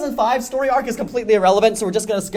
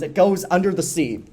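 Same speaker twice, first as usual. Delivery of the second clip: normal talking voice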